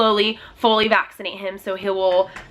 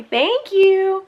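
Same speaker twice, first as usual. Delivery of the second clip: high, singsong thank-you; parent's high-pitched thanks; 9 semitones higher, 4 dB louder than usual. Real, not acted. Thanking toddler for a sticker.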